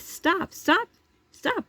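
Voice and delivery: high, rising repeated stop; shrill retold call to stop him; calling after a man walking away, high and urgent. Retold shouting at worker.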